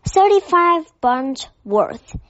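said with bitter disappointment. A narrator's reading. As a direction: sour and let down. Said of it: "he said bitterly".

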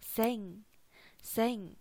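생 with a clear back-nasal ng ending; syllable demo. Shown as a demonstration. Teacher to students, modelling 생 with its ng. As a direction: model 생 and let the ng ring. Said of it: "然后再加个后鼻音".

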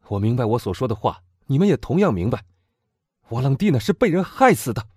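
put-on loud, high, fervent young man; impassioned young man. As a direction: demand revenge with burning passion. Morrel accuses. (激昂的青年)

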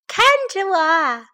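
high, loud cub's call; loud shout. A narrator's reading. Baby bear showing off by the stream, high shout. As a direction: shout for all to look. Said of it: "熊宝宝大声喊道".

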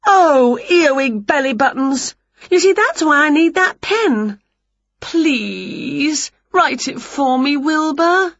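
high, whiny begging; pleading witch. A narrator's reading. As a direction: beg like a frustrated witch. Witch frustrated, begging cat.

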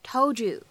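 "told you" blended into "tol-joo"; "told you" linked as one word, "tol-joo"; assimilated sounds. Shown as a demonstration. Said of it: "我们呢可以变成told you".

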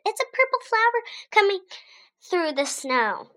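high, fast excited discovery. A narrator's reading. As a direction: blurt out the news. Rosie spotting the flower.

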